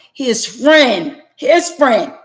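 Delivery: FRIEND swooping high and loud, then falling; emphatic repetition. Real, not acted. Wronged wife hammering home who turned on her husband, emphatic. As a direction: punch out "friend" loud and high, both times. Stressing who betrayed him.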